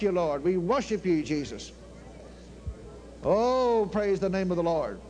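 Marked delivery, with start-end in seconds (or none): none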